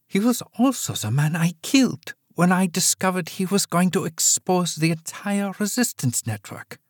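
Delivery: mother admits the killing to daughter; old mother, cold confession. Acted. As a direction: say it plainly, no apology.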